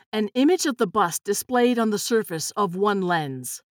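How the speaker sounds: ordinary delivery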